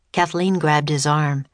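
ordinary delivery

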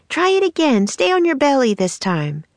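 high voice, easing down as it goes; urging girl. A narrator's reading. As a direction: egg him on in a high girl's voice. Annie urges Jack to retry.

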